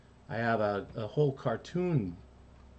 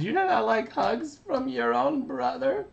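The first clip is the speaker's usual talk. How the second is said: put-on high hurt little kid voice; Carbonate voice; 5 dB louder than usual. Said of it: "so he says".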